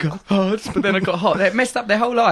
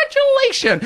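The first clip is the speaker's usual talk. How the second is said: mock diva; 4 dB louder than usual.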